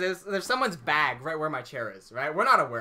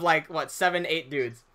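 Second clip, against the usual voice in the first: usual speaking voice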